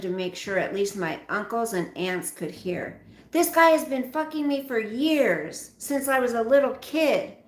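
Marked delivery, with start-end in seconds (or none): 3.3-7.4 high and loud accusation. Acted. Daughter accusing her father so the uncles hear, loud and high. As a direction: call him out for all to hear.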